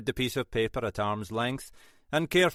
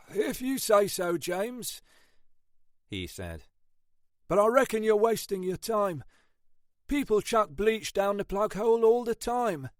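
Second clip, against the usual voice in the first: a lightly voiced doubtful sergeant, higher; sceptical Sergeant Ashford; 10 semitones higher than usual. Ashford brushes off Munro's drain theory, lightly voiced. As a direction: shrug off the boss's hunch.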